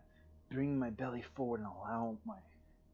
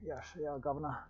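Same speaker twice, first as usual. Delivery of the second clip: lightly put-on British accent, a bit higher; a playful cockney touch on "governor"; half-joking British accent slipping out mid-stretch; mock British accent; 4 semitones higher than usual. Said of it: "when the accents start coming out".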